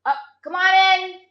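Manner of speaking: high, hammed-up greeting voice; mock greeting. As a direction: greet the friend mock-cheerfully. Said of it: "my friend walking in".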